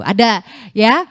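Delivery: usual speaking voice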